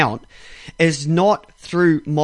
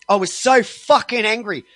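ordinary talk

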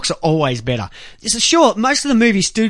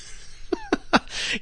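airy unvoiced laugh; soft breathy chuckle; 9 dB quieter than usual. Real, not acted. Laughing at own joke.

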